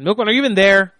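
loud impatient call across the room; shouting for a missing cohost. Real, not acted. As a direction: raise the voice to find him. Host calling out to absent cohost.

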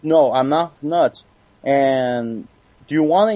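normal talking voice

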